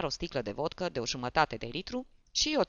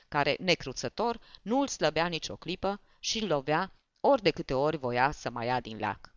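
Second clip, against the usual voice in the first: ordinary delivery, nothing marked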